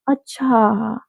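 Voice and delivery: अच्छा said softly, final vowel stretched long; soft, lingering अच्छा with no question in it; drawn-out अच्छा. Shown as a demonstration. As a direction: let अच्छा trail out in a long, soft "oh, I see". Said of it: "अच्छा word के उसने बहुत सारे वेज".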